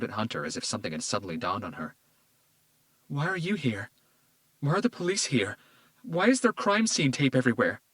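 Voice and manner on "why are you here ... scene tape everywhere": higher, building to louder panic; questions tumbling out in fear; rising alarm. Acted. Daughter sees police, alarmed.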